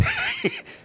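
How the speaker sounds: high squeaky giggle. Real, not acted. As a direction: burst into a tiny high laugh. Laugh after joke.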